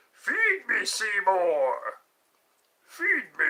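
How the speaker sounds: high, loud demanding plant-monster voice; man-eating plant voice. Pretend. Hungry monster plant demanding food from Seymour, high and loud. Little Shop plant quote.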